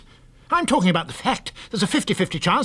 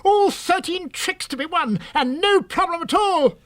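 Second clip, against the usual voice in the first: higher, slow, savoring each word; thrilled boast; 10 semitones higher, slower than usual. Acted. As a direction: announce the grand slam in triumph. Frank thrilled by once-in-a-lifetime hand.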